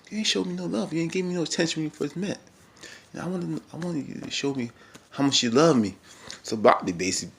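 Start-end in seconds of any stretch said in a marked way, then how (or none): 0.1-6.0 lightly voiced pleading boyfriend, pitched up; a hint of a hurt partner complaining; imitating Jeffrey, higher. Vlogger mimics Jeffrey pleading with Bobby, lightly voiced. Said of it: "Jeffrey was like".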